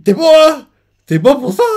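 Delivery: high, loud thrilled outburst; excited. Real, not acted. Fan reacting to the scene, excitedly. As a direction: cheer at the screen.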